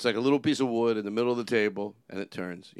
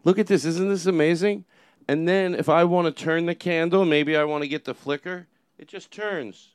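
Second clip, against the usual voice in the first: infomercial pitch; 3 semitones higher, 7 dB louder than usual. Acted. Host mimicking bad infomercials, a loud sales pitch. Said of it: "those bad infomercials".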